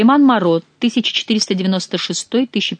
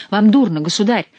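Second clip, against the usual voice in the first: loud alarmed cry; frightened cry; 5 semitones higher, 6 dB louder than usual. A narrator's reading. Young woman frightened the king is fainting, loud cry. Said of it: "испуганно воскликнула молодая женщина".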